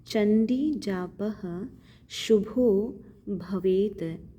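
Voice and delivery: जापः with visarga, शुभो भवेत drawn out; slow recitation. Shown as a demonstration. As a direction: chant the line slowly, word by word. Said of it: "दो बिंदु विसर्ग कहलाती है". (धीमा पाठ)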